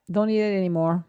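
usual speaking voice

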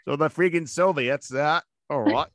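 put-on hammy British accent with upturned ending; resume the topic in a fake British accent; mock British accent. Co-host returning to the Soviets, mock British accent. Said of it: "except for my British accent".

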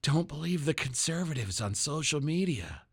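soft mock-instruction to children; talking to little kids. Host voicing the government telling little kids, soft mock warning. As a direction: say it softly, as if coaching small children. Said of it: "is trying to tell little kids".

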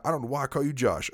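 ordinary delivery

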